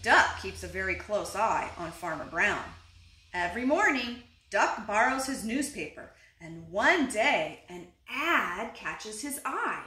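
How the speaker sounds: usual speaking voice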